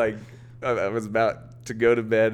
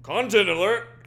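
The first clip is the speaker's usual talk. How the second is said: high siren-like call of Content alert; mock alert; 10 semitones higher, 3 dB louder than usual. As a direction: announce Content alert like an alarm. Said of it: "mock alert call".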